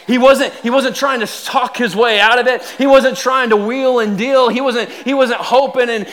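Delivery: high, loud, driving delivery; impassioned preaching. Real, not acted. Proud father preaching about his son, impassioned. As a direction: build with proud, forceful conviction.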